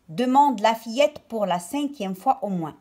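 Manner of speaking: usual speaking voice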